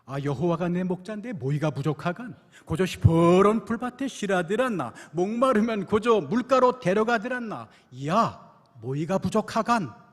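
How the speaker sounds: loud, emphatic Pyongan dialect reading. Retold or imitated. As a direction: proclaim it in broad Pyongan speech. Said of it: "평안도 버전입니다".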